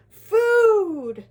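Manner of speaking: huge high drawn-out yell; one loud, excited shout. A narrator's reading. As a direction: bellow it like hungry dwarves. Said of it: "shouted the dwarves".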